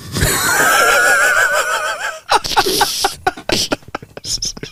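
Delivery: long high wheezy laugh; real helpless laugh, trailing away. Real, not acted. Laughing hard at the punchline of a joke, high wheezy laughter.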